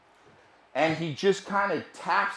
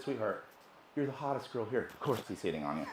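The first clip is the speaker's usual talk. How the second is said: low, soft, offhand reply; placating husband; 7 semitones lower, 16 dB quieter, faster than usual. Retold or imitated. Husband brushing off his wife's complaint, quiet and placating. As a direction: reassure her quietly, keep it low. Calming wife who complains of advances.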